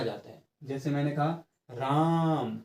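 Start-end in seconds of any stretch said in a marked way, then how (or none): 1.7-2.6 राम drawn out long, the आ held; long drawn call. Shown as a demonstration. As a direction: stretch राम like calling across a stage. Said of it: "बहुत लंबा सा". (राम को लंबा खींचकर पुकारना)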